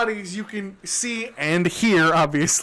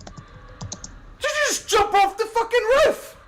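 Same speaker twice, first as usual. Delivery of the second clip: high startled guard shout; 14 semitones higher than usual. Acted. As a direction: yell it up at the roof. Guard catches someone jumping.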